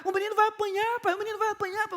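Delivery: put-on high, whiny child's voice near tears; crying little boy. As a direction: imitate a scared little boy about to cry. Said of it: "ele começando a chorar". (filho pequeno chorando com o filme, voz aguda e chorosa)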